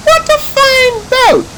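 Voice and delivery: very high squeaky duck voice; little duck voice. Acted. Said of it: "said the little duck".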